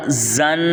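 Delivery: زن with a sharp buzzing z; firm whistled z in زن; hard whistling z. Shown as a demonstration. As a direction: say زن as "zzan". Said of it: "اس کو پڑھا".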